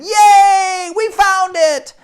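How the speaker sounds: high, loud cheer of joy; excited shout. Acted. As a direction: cheer like kids who found the lost thing. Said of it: "they said".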